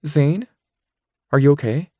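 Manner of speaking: Rachel, worried. Acted. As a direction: Rachel suddenly notices Zane's face. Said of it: "they widen in shock".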